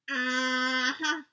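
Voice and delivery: a lightly voiced guard's doubtful hum; sceptical mm-hmm. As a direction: hum it like you don't buy a word. Guard brushes off the goodbye.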